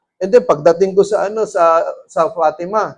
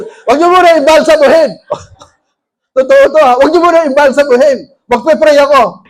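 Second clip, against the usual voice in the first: urgent command; 5 semitones higher, 6 dB louder than usual. Retold or imitated. Ordering people not to embalm.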